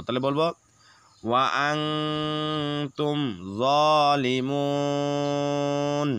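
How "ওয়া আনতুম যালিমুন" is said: slow recitation, final vowel drawn long; long madd at the stop. Shown as a demonstration. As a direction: hold the mu in যালিমুন for three beats. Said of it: "তিন আলিফ টেনে পড়বো".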